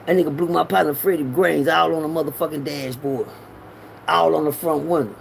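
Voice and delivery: normal talking voice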